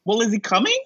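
high, concerned voice; Noah's worried question. Retold or imitated. Noah asking LaChance what's wrong, high and concerned. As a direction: ask with worry. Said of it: "so Noah says".

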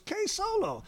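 very high, imitated hail by name; showy greeting. Mogul greets artist walking in, high showy hail.

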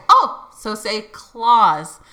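slow, drawn-out coaching on "claus"; retold coaxing, stretch out "claus"; coaxing correction. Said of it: "And I said".

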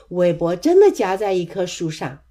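plain reading voice

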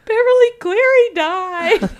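high, loud, tearful laugh through the words; laugh-crying quote. Retold or imitated. Retelling her own reaction to family, laugh-crying. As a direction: wail it while laughing at yourself. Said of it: "I'm like".

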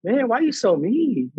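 mock-hurt schoolyard complaint; childlike protest. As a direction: complain like a kid, drawn out. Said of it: "one of the most pure kid elementary playground".

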